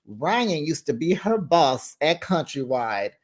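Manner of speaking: ordinary talk, nothing marked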